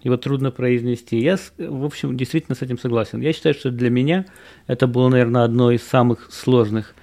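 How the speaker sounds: ordinary talk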